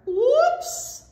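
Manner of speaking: very high, loud slip cry; startled yelp. A narrator's reading. Bear slips on soap, startled loud yelp. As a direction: yelp it out suddenly.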